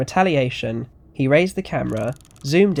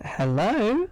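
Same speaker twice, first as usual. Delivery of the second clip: put-on sweet old lady; 8 semitones higher than usual. A narrator's reading. Grandmother answering the phone, sweet and unthreatening. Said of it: "Erica's sweet and unthreatening voice".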